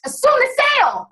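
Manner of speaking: high, emphatic mantra; pumped-up coach repeating the key line; fired-up emphatic. Real, not acted. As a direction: punch it like a rallying cry.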